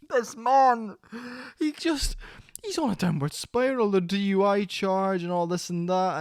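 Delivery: mock-outraged rant, pitch leaping high; incredulous rant. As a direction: blurt it out like you can't believe what you're seeing. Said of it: "I was just like".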